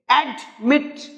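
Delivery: "admit" in two beats, ad, mit; split into syllables. Shown as a demonstration. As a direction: break "admit" between "ad" and "mit". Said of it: "अब ये एक syllable के word नहीं है".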